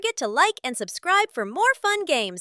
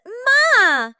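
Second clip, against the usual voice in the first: panda call; 4 semitones higher, 6 dB louder than usual. Acted. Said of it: "The panda says".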